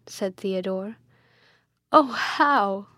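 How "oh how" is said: louder, sudden exclamation; anguished cry. A narrator's reading. Friar hears of the mother's death, crying out. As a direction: cry out in distress. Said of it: "cried Jerome".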